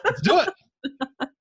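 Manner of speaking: slow words squeezed out through real laughter; laughing. Real, not acted. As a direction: laugh your way through it, drawn out. Laughing along with guest's joke.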